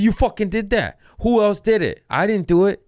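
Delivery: high, loud retold argument; acted-out indignant yelling; heated accusation. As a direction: accuse someone with your pitch shooting up.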